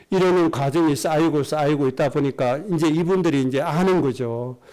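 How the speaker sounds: ordinary talk, nothing marked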